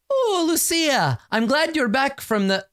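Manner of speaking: a mother trilling a surprised hello; mother's high greeting. Acted. Mother greeting her returning daughter, high and drawn out.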